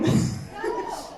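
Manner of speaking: squealing giggle; high embarrassed laugh. Real, not acted. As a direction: giggle away the awkward question. Embarrassed by the question.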